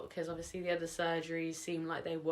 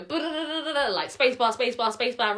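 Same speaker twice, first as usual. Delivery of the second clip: excited, rushed; 7 semitones higher, 10 dB louder than usual. Real, not acted. Retelling the rapid procedure.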